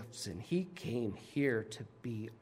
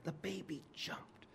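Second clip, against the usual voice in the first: hushed whisper; 4 semitones higher, 10 dB quieter than usual. Real, not acted. Telling baby leaping in womb.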